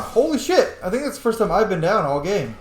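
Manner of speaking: normal talking voice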